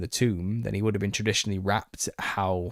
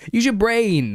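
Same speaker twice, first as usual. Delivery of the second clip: much higher and louder, frustrated; angry outburst; 13 semitones higher, 8 dB louder, slower than usual. Real, not acted. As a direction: lets the irritation burst out at the commenter. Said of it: "I'm just getting angry".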